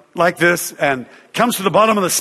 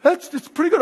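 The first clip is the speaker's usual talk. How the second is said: higher, lightly voiced relieved girl; 11 semitones higher than usual. Girl on stage says the pain is fading, lightly voiced. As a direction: ease off the pain as it fades. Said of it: "she goes".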